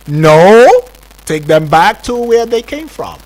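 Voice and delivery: loud, high-pitched order; mock indignant command; acted refusal. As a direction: refuse forcefully, as a president giving orders. Acting out the president refusing the landing.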